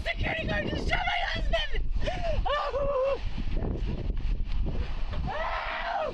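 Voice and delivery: anguished cry. Real, not acted. Wife cries out over shot husband.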